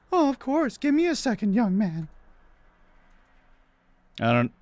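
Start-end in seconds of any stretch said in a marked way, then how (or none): 0.1-2.1 high, polite falsetto; obliging woman. Acted. Woman agreeing to make her guest coffee, polite high falsetto. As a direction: play an agreeable lady in a high voice.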